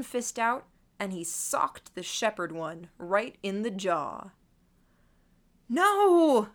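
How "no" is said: long, high, loud cry of dismay; anguished, drawn-out shout; screamed protest. A narrator's reading. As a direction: scream it out in disbelief. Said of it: "I screamed in my mind".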